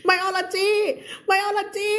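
half-whining cry of the word biology; mock wail. Real, not acted. Teacher fake-crying over forgetting the Thai word. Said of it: "ไม่ใช่เคมี ไม่ใช่ฟิสิกส์".

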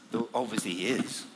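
ordinary delivery, nothing marked

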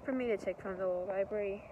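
ordinary delivery

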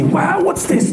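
high, amazed gasp of wonder; amazed villagers. Retold or imitated. Villagers seeing pictures of snow.

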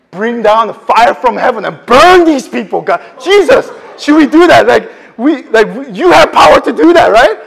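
loud, high, eager pleading; eager disciples. Retold or imitated. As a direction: beg for the fire with glee. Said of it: "I can just see their excitement".

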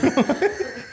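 high breathy giggle. Real, not acted. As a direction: let out a high short laugh.

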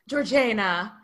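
Georgiana with JAY as the stressed syllable; alternative pronunciation. Shown as a demonstration. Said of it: "they said Georgiana".

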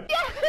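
squeaky high whoop before a shot; high yahoo cry. Real, not acted. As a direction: yelp it up high. Shot-taking ritual cry.

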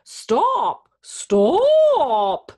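high, loud cow moo on a word. A narrator's reading. Cow calls after the runaway, mooing. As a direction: stretch the second word into a long moo. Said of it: "the cow mooed".

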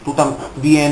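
normal talking voice

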